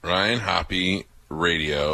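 each word slow and separate; slow, clear dictation. Shown as a demonstration. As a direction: spell it out slowly for the listener. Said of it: "repeating the email".

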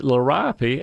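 liriope said as luh-RYE-uh-pee; caller's pronunciation. Retold or imitated. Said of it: "you've pronounced it liriope".

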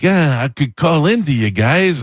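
drawn-out mocking imitation; mock aloof. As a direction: imitate a newly famous guest, condescending. Mocks comedian gone famous.

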